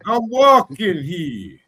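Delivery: loud, high, drawn-out New York accent. Shown as a demonstration. Host repeating his party piece, loud hammed-up New York accent. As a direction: shout it slowly like a movie New Yorker. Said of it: "I can do a New York accent pretty good".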